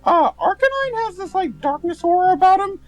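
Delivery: high, bright-pitched bro character; Bob's voice. Acted. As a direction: chat with a friend as the cheerful Bob. Bob character voice.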